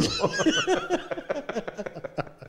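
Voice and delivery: a long high burst of laughter. Real, not acted. Host laughing at the burro joke. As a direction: crack up at your own joke.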